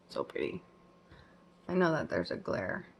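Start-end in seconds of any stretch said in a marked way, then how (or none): 0.1-0.6 whispered. Real, not acted. Repeats in a breath.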